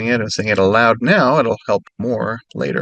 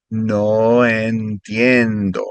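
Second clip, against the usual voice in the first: slow, stretched out; slower; much slower than usual. Shown as a demonstration. Tutor repeating the phrase slowly for students. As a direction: let each syllable sit. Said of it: "normal and then a little slower".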